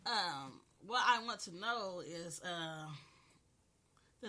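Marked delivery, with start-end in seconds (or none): none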